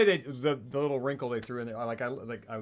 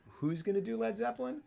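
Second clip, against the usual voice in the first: incredulous; 12 semitones higher than usual. Retold or imitated. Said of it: "I was like".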